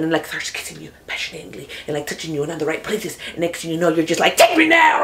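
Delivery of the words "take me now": high, loud cry of lust; hammed-up lovesick shout; passionate shout. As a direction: throw yourself into a swooning plea. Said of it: "I get too into it".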